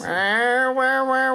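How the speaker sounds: loud, high wailing cat noise; cougar yowl. Acted. Host showing off an intimidation sound, loud high cat yowl. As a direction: yowl it loudly like a big cat. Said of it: "you sound like a cougar".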